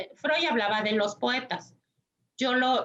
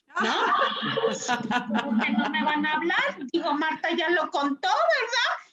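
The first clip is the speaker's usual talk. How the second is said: excited disbelief; 5 semitones higher than usual. Real, not acted.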